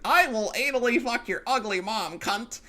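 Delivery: high mock Australian troll voice; mock quote. Quoting the Australian rival's threat, high mocking voice. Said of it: "he says".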